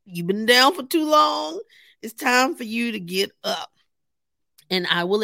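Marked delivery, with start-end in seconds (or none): 0.0-3.7 rally yourself like a firm friend; pep talk. Retold or imitated. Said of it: "I was pep talking myself".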